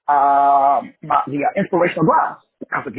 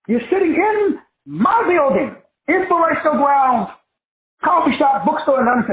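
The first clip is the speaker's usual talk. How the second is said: exasperated retort; 8 semitones higher than usual. Retold or imitated. Owner to a friend lying about opening the shop, exasperated. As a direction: let the disbelief climb on every word.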